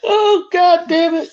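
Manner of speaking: very high, slow, strained squeal; exasperated outburst. Real, not acted. Host reacts to co-host on sex question, high exasperated squeal.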